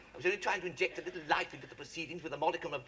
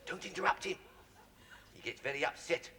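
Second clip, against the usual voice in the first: ordinary talk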